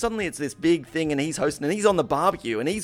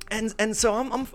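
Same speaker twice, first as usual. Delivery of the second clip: usual speaking voice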